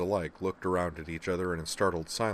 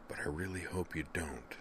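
much softer confidential aside; undertone; 9 dB quieter than usual. Acted. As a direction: lean in and say it privately. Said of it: "added in an undertone".

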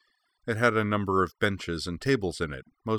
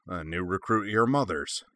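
lightly voiced gruff dwarf; a hint of dwarven gruffness. A narrator's reading. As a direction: size up a newcomer as a gruff smith. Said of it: "said the dwarf in a gruff voice".

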